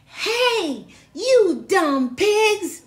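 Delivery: loud, high, slow yell at the pigs. Acted. Megan yelling at the pigs in the pen, loud and slow. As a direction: shout it out, dragging each word. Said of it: "and yelled".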